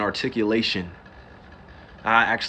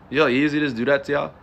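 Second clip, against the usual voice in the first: ordinary delivery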